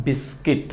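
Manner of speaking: "বিস্কিট" with a short i in the second syllable, bis-kit; correct pronunciation. Shown as a demonstration. Teacher models the right word for learners, correct pronunciation. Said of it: "সঠিক উচ্চারণটা দেখে নিই".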